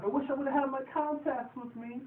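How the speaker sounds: high, put-on character voice; put-on whiny excuse. Voicing the drunk driver's excuse.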